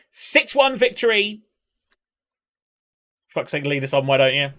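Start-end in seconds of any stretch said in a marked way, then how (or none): 0.1-1.4 triumphant announcement. Real, not acted. Announcing win.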